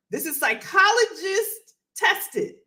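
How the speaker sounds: ordinary delivery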